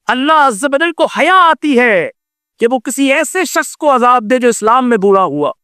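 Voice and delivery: normal talking voice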